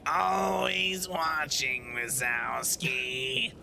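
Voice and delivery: Roz impression. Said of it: "Fucking Roz from Monsters, Inc".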